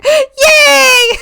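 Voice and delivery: high, loud cheering shout. Real, not acted. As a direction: shriek with delight. Streamer switched accounts back.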